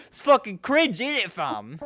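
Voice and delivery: put-on high, loud parody British accent; mock British accent. Host mocks his co-host by repeating his line in a silly British accent. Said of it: "That was a fucking terrible accent".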